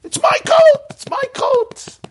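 very high, mock-hysterical yell; comically shrieked claim; retold inner scream. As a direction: scream it at the top of your range, twice. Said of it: "you know what I was screaming?".

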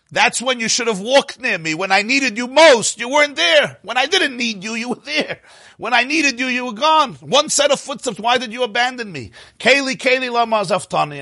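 high, pleading protest; anguished reproach. Acted. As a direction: cry out as one who feels abandoned. Man in story reproaches God for abandoning.

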